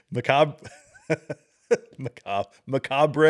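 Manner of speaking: macabre mangled as "ma-cob-ray", through laughter; mimicked mispronunciation. Host mocks what she said, mimicking mispronounced macabre as "ma-cob-ray". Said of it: "Isn't that what she said?".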